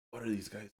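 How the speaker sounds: very soft, under-the-breath aside; muttered judgment. Retold or imitated. Ticket seller eyeing two costumed losers, muttered quietly. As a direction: mutter it quietly as if privately judging them.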